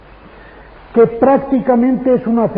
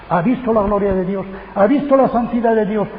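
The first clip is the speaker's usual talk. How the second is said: ordinary delivery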